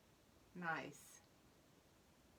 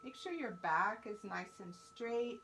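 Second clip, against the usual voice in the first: measured meditation voice with long pauses; guided mindfulness; 2 semitones lower than usual. Real, not acted. Teacher leading fourth graders to their breath, calm guidance.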